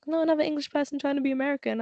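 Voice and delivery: rushed mocking stranger's jab; fast dismissive quote. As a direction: fire it off quickly with a sneer. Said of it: "He was like".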